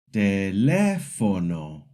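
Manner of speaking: teLÉfono, slowly, second syllable strong; slow teléfono for a learner to repeat, weight on lé; practice word stress. Shown as a demonstration. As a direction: draw out teléfono, leaning on lé. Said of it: "Repeat after me".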